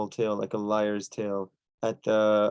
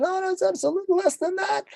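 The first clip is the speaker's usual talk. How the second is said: character voice. Acted. Choni voiced in told story.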